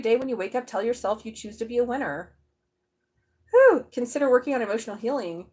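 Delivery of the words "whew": amazed whew. Real, not acted. Reacting to an intense card.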